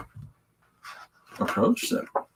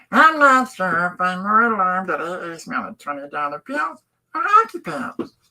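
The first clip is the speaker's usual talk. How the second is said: much higher, squeaky comic take of the same line; high silly voice; 9 semitones higher, 5 dB louder than usual. Shown as a demonstration. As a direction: redo the line as a goofy cartoon character. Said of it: "Hold on, I gotta do a voice".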